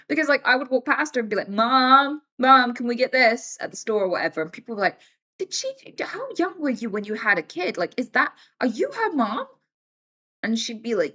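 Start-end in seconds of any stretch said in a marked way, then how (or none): 1.5-3.6 put-on higher, louder childlike call to mom; mock kid calling mom. Kid asking mom in store. 5.4-9.5 much higher amazed voice; mock disbelief. Strangers amazed at young mom.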